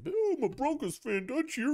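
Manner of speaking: very high mocking fan voice; mock whiny fan. Broncos fan objecting to the host, high whiny mockery. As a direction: squeal it like an indignant fan.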